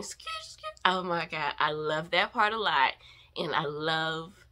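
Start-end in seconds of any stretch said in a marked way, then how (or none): 0.0-0.7 imitating Bam Bam. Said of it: "you can hear Bam Bam saying".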